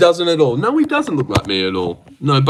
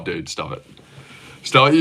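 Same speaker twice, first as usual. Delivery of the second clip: normal talking voice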